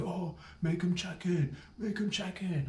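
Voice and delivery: high, pushy put-on voice of the tiny fella; little guy egging on. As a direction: needle them to make him check in. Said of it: "He's like".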